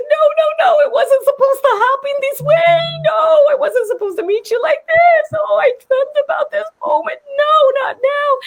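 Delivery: high, laughing-crying plea. Retold or imitated. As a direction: sob and laugh at once, overwhelmed. And I started crying.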